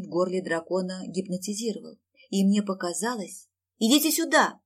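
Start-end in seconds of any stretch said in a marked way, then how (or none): 3.8-4.6 calling out loudly and high; shouting. Acted. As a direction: call the others over. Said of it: "крикнула я".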